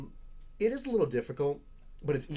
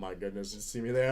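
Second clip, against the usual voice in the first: normal talking voice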